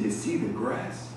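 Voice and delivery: hushed, unimpressed retold question; quiet questioning echo. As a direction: drop low and soft, repeating it back. Veteran echoes the answer back.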